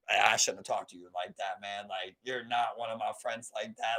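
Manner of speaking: low, soft, humbled apology; quietly owning up to a friend; sincere apology. Retold or imitated. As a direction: retell a contrite apology, voice dropped.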